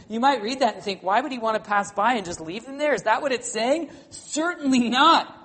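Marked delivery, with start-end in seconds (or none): 1.0-5.4 loud, high, ringing pulpit voice; thundering sermon delivery that climbs in pitch; full-force preaching. Acted. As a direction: preach it out over the whole room.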